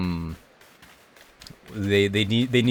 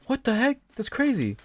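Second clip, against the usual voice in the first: excited shout. Real, not acted. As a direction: yelp it out in amazement. Surprised by attacking Pokémon.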